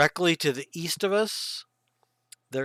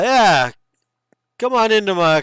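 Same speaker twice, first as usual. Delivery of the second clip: lightly voiced chief, louder and higher; barbarian chief; 5 semitones higher, 8 dB louder than usual. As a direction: welcome visitors in as the chief, loudly. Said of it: "so the chief says".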